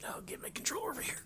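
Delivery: quick whispered aside; whispering. Real, not acted. Muttering aside.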